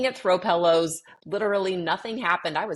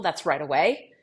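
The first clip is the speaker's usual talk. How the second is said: normal talking voice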